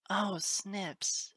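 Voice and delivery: high, tender sigh of pity; tender sympathy. A narrator's reading. Teacher cradling the hurt colt, tender pity. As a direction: breathe out sympathy for the child.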